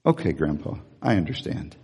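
soft, low, meek "okay, Grandpa"; meek, softened child voice; little boy voice. Retold or imitated. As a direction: voice a small boy giving in. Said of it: "he said".

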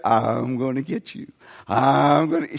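low, playful "I'm gonna get you" tickle threat; playful grandpa tease. Retold or imitated. Grandfather teasing his baby granddaughter, low playful threat. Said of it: "I do the".

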